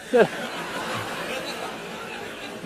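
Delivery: a soft airless snicker at them; quiet mocking snicker; silent laugh. Real, not acted. Laughing at the chat.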